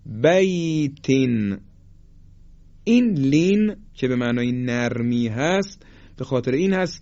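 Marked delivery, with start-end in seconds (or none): 0.1-1.1 بیت drawn out, soft یا glide; slow soft leen demo. Shown as a demonstration. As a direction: stretch بیت gently. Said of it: "یا ساکن ما قبل مفتوح".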